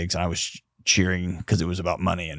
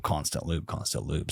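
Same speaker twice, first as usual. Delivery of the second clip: each repeat softer, dying away; fading echo; 4 semitones lower, 11 dB quieter than usual. Shown as a demonstration. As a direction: let the words trail off into near silence. Echoing the phrase, fading out.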